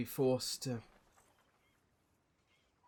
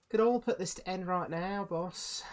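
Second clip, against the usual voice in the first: a touch of a menacing rogue; Norma voice; 8 semitones higher, 5 dB louder than usual. Acted. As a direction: tempt the boss, dagger in hand. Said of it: "she'd just be like".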